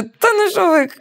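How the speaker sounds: high, loud disbelieving cry; incredulous. Retold or imitated. To Peter at the restaurant, high shocked disbelief.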